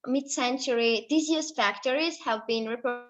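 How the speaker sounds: normal talking voice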